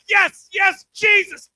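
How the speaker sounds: high, loud ecstatic cry; mock passion. Husband crying out during sex, hammed-up loud. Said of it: "I'm like".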